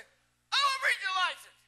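high, loud, slurred imitation; mimicked thick-tongued demand; Virgil's garbled speech. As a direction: holler it slowly with mushy words. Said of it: "I thought he said fishing license".

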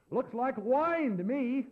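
high, loud, drawn-out announcement; imitated tactless drunk, stretched and loud. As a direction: announce it slowly for all to hear. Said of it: "he said in a loud voice".

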